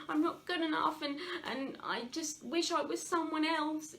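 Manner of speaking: hint of a tearful little child; crying child voice. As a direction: voice a small child who feels not good enough. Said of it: "little child crying and you're going".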